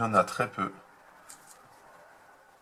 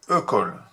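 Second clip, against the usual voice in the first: "école" said louder, the accent hinted; stressed word; 5 dB louder than usual. Shown as a demonstration. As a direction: point at "école" with emphasis. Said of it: "Car pour faire école". (« école » appuyé)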